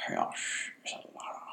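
slow, hushed whispery impression; Littlefinger impression. Podcaster trying out a character's distinct accent for co-host, whispery impression. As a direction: draw it out low in volume, an amateur's accent attempt. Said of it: "That's my impression".

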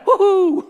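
loud, high whoop of fake glee; mock cheer. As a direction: whoop it up ironically. Mock cheer about his role.

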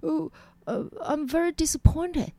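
imitating a faltering English speaker; stumbling. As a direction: hesitate and grope for the words.

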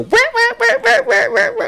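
very high, loud wailing bursts; imitated blaring siren. Cohost mimics Jesse's aggressive track, high loud wailing. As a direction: blare it out high, over and over.

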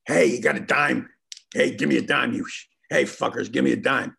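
gruff street beggar demanding a dime; rough panhandler shouting after passersby. Retold or imitated. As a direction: hassle them for change. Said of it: "we hear this voice going".

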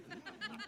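short laugh. Real, not acted. Laughs at the blowout.

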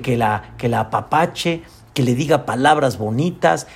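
normal talking voice

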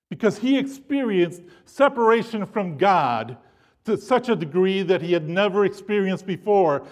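usual speaking voice

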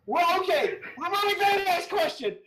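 laughing through the words; trying to talk through a laugh. Real, not acted. Laughing host pushes on.

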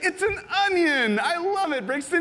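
high-pitched, hammed-up gushing; playacted parental excitement; mock delight. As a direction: gush over a child's odd gift. Acted dad gushing over gift.

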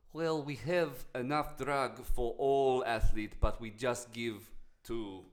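put-on slow Russian accent. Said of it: "Can I keep doing my Russian accent?".

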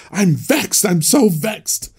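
high, slow whiny pout of a sulking emperor; petulant emperor. Retold or imitated. Teacher mocking the Gladiator emperor, high and whiny. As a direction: whine it like a spoiled ruler who lost the crowd. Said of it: "took that word serious".

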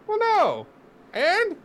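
high cartoon falsetto mocking the exchange. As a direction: squeak it like a cartoon. Voicing dialogue in high voice.

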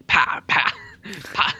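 imitated gunshot pops; pa pa pa gun noises; mouth gunshots. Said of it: "they're like".

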